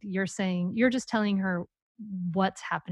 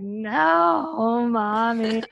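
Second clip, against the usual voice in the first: lightly voiced kid correcting mom; child protesting; 2 semitones higher, 4 dB louder, much slower than usual. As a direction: imitate an amused child's drawn-out objection. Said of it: "And she'll be like".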